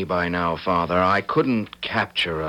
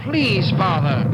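desperate begging; 11 semitones higher, slower than usual. Acted. Son begging his father to stop, high frightened plea. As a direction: beg for mercy.

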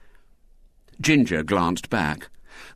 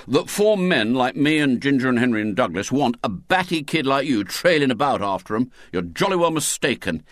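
emphatic, higher schoolboy scolding; stern; 6 semitones higher, 3 dB louder than usual. A narrator's reading. William telling off the trailing girl, stern. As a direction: lay down the law sternly. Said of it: "said William sternly".

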